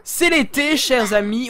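high, loud, festive announcement; summer proclamation. Acted. Streamer telling dear friends it's summer, loud and festive. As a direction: proclaim it brightly to the crowd.

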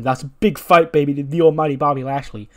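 punchy imitation of a wrestling hype-up; imitated hype man. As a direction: gas him up like his manager. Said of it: "M V P's there gassing him up".

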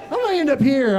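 lightly acted lost-soul protest; mock bewilderment. Comic from small-town Texas wondering aloud, mock bewilderment. Repeats bewildered question, explains himself.

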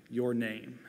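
ordinary talk

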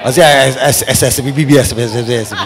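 low-pitched stammered "S, S, B, B" in place of the word; mock mispronunciation. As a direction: fumble the word into "S, B, B, S". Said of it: "Some people can't pronounce it".

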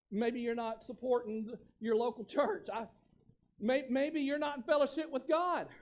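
usual speaking voice

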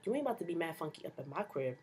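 ordinary delivery

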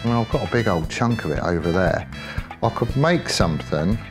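ordinary delivery

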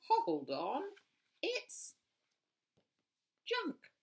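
rising high-pitched disbelief; surprised officer. A narrator's reading. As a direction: squeak it in surprise. Skunk surprised at the loot.

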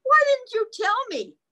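high, loud excited cry; cried out. Acted. The whale cries out to Peter, high and loud. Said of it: "cried the whale".

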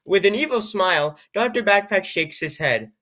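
ordinary delivery, nothing marked

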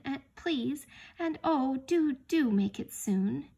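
high, loud, urgent plea; beg, stretching out the last words slowly; earnest pleading. A narrator's reading. Lucy begs Aslan to let them return soon, pleading.

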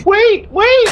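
high, imitating faint cries; faint wait calls. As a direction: mimic a faint distant wait. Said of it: "I hear very faintly".

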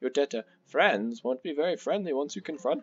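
sing-song storybook guardian, gravely advising; wise old Elder Kettle. Acted. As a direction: warn the boys like a theatrical elder. Voices Elder Kettle's dialogue in game.

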